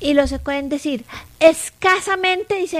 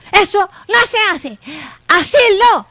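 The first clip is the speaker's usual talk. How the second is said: louder, firm scolding of a dog; 3 semitones higher, 7 dB louder than usual. Shown as a demonstration. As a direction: tell the dog off sharply. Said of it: "si tú lo regañas".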